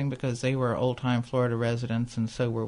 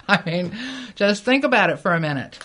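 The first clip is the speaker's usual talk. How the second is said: ordinary talk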